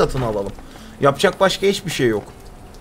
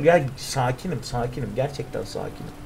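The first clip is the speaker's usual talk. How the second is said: insisting on being calm; 7 semitones lower, 7 dB quieter than usual. Real, not acted. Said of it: "Lütfen sakin ol".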